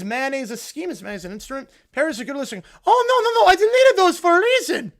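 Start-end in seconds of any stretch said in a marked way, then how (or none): 2.8-4.9 loud, high mock panic; panicked cartoon villain. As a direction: plead as the villain, hammed-up. Villain's tweets exposed.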